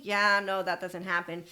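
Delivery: ordinary talk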